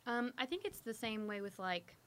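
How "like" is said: real Southern slip on "like"; Southern accent. Real, not acted. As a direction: let "like" slide into a Southern vowel. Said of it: "That like was really Southern".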